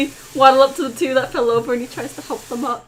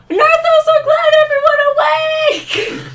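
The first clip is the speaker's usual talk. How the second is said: high, loud yelled greeting; gleeful yell; 5 dB louder than usual. Acted. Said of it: "Do you yell that?".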